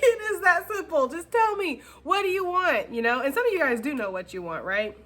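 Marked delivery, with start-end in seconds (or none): 0.0-2.9 Notebook movie hero. Retold or imitated. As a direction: overact the desperate lover demanding an answer. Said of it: "It's like".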